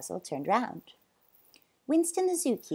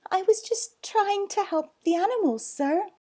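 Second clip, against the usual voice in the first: put-on high, louder earnest boy voice; anxious pleading; 12 semitones higher, 6 dB louder than usual. Boy explaining himself to zoo owner, anxious. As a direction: plead your case, pitched up.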